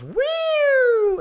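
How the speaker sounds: very high, loud drawn-out whistle-like "woo"; imitated droid shriek. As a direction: squeal like a startled little droid. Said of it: "the little droid goes".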